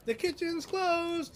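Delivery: high, slow mock yell. A cook yelling to the whole tavern, mock yell. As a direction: call it across the room. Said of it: "someone yells out".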